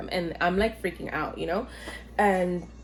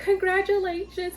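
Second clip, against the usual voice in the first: high-pitched cheery well-wisher; singsong high congratulations; mock congratulations; 12 semitones higher than usual. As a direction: imitate staff congratulating, pitched way up. Staff congratulating her as she cries.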